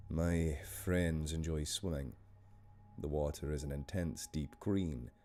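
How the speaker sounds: measured Scottish story narration; Scottish storyteller, unhurried; Scottish accent. Shown as a demonstration. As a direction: read the tale in a Scottish accent. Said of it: "I'm gonna do this one in a Scottish accent".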